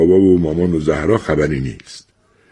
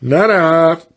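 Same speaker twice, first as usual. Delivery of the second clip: drawn-out voice; 10 semitones higher, much slower than usual. Acted. Sleepy Mr Bahari answering the crowd, drawn-out higher voice. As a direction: stretch the word out lazily, higher. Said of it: "با یه صدای کشدار گفت".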